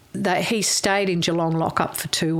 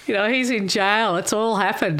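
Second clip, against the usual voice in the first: laughing; 4 semitones higher than usual. Real, not acted. Still laughing after the laugh.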